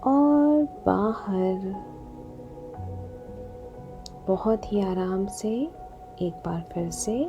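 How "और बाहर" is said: very slow, stretched vowels; drawn-out exhale cue. Acted. Guide pacing the listener's out-breath, drawn-out exhale cue. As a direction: draw each word out to last a whole out-breath.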